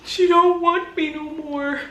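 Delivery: high, slow, hammed-up fake sobbing; mock crying. Mocking the ex crying over her, hammed-up sobbing. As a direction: wail like a heartbroken ex, overdone. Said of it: "Sliding down walls".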